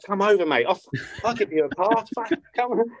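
gleeful invite. Retold or imitated. Inviting mate Ryan to Prague.

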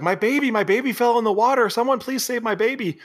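high-pitched frantic woman; mock panicked plea. Woman begging someone to save her baby, mock panic. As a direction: plead for rescue in a high put-on voice.

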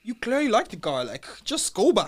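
loud, high exasperated insistence; retold heated retort. Retells heated argument with her.

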